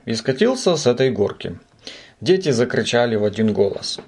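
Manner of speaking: usual speaking voice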